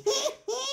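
high mad cackle; shrieking giggle of glee. A narrator's reading. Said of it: "Morphin cackled again".

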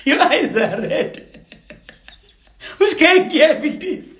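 giggling through the words; can't hold back the laughter; teasing chuckle while talking; laughing. Acted. Madam laughs at crying maid.

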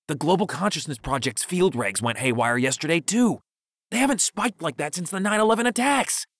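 high-pitched, worked-up conspiracy rant; hyper cadence. A narrator's reading. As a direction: keep it hyper, pitch jumping up. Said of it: "continued in his hyper cadence".

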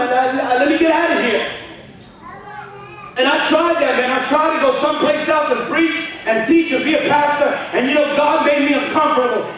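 loud, high fired-up sermon; preaching at full force. Acted. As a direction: preach it out to the whole room. Preaching to congregation.

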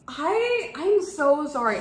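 high, loud, overcome apology; overwhelmed. Real, not acted. Unboxer speechless at the gift, overwhelmed. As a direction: burst out apologising, lost for words. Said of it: "I literally have no words".

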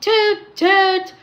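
loud, high imitated toots; two punchy, high toots; impatient horn. A narrator's reading. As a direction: mimic a bus horn honking impatiently. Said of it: "he heard an impatient".

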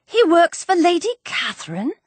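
high, incredulous question; astonished cry. A narrator's reading. Daughter interrupting the letter about Lady Catherine, high and astonished. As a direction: ask it high, in disbelief. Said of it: "cried Elizabeth".

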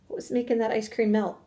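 usual speaking voice

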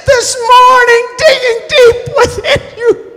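high, loud, impassioned preacher's shout; full-force preaching. Acted. Preacher urging the church to hear the Holy Ghost, full-force preaching. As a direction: cry it out at the top of the preaching voice.

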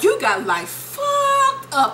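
very high, slow, drawn-out indignation; mock outrage. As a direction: stretch it out as the outraged wife. Voicing wife's outburst in divorce hearing.

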